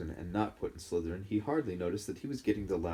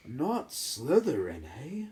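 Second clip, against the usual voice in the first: "Not Slytherin" drawn out slowly, higher, eh rising; hat questions Harry's choice; small hat voice; 5 semitones higher, much slower than usual. Acted. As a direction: echo his plea back, amused and slow. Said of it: "said the small voice".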